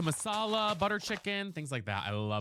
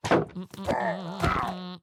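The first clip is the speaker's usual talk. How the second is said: mock sob hum; 5 dB louder than usual.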